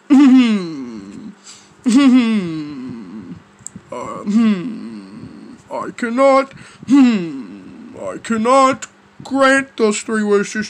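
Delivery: low, slow genie hums and refusal; pondering genie. Acted. Genie refusing Joshua's three wishes, low deliberate voice. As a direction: hum deep in thought, then decline slowly. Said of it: "the genie will be like to me".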